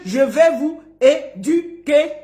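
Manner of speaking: slow, spaced "é-du-quer" for "éduquer"; dictation. Shown as a demonstration. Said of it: "Écrivez".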